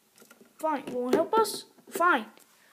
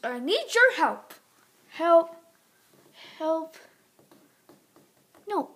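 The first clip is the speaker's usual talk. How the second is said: ordinary talk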